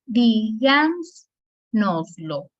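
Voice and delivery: dígansnoslo very slow, DÍ-gans-nos-lo; syllable by syllable. Shown as a demonstration. Teacher demonstrating sobresdrújulas, dígansnoslo very slowly in separate syllables. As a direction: stretch dígansnoslo, first syllable long and stressed. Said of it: "Estas tienen cuatro sílabas".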